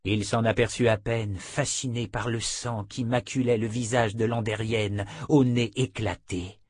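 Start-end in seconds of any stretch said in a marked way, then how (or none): none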